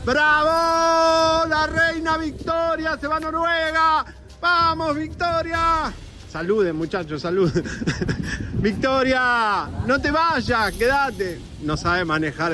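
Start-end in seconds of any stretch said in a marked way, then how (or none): 0.1-5.9 very high, sustained cheering cry. Real, not acted. Reporter as Victoria leaves, cheering her off. 8.5-11.4 high, stretched-out plea; calling after someone. Real, not acted. Reporter to departing Victoria, calling after her.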